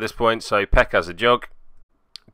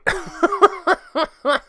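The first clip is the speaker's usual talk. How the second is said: high squeal laugh. Real, not acted. Laugh after "everything is fine.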